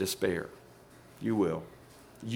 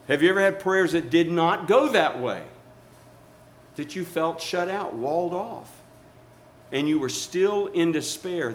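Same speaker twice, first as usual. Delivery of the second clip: ordinary talk, nothing marked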